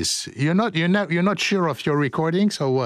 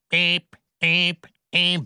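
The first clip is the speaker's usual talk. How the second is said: imitated alarm beeps; 3 semitones higher, slower than usual.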